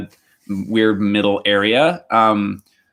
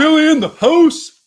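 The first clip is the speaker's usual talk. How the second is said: higher, louder hype-man call; hype announcer shout; 7 dB louder than usual. Pretend. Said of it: "I don't know why you said it like that".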